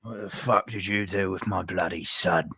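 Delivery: mock Cockney menace; Butcher. Co-host as Butcher confronting someone about a son, mock Cockney. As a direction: demand it in put-on Cockney. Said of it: "He uses a Cockney accent".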